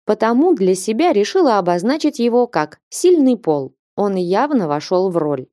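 usual speaking voice

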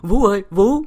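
high, loud frightened call; panicked cry. A narrator's reading. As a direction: cry out in alarm. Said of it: "hốt hoảng kêu lên".

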